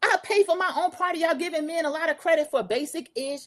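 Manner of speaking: high, lightly voiced indignant boast; mocking haughty retort. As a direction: mimic her huffy pride. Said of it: "Contessa said".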